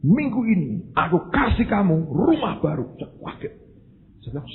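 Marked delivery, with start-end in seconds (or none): 0.0-2.9 imitated shout. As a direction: call it out high like a mysterious voice. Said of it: "Dia teriak, bilang ini". (tiruan teriakan bernada tinggi)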